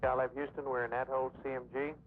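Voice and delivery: crackly radio transmission; tinny radio comms voice; astronaut radio. Real, not acted. Mission control radio transmission.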